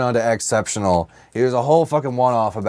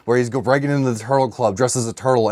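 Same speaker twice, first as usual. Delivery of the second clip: normal talking voice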